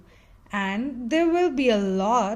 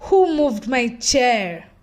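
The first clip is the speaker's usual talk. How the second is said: snappy complaint; 7 dB louder than usual. Acted. Snappy grape snaps at others.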